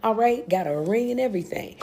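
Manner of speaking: normal talking voice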